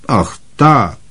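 Aunt Marge. A narrator's reading. Marge nods.

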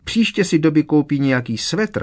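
high, loud, cheerful elf voice; happy house-elf. A narrator's reading. Dobby explains his shopping plan to Harry, happily. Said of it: "vysvětloval šťastně".

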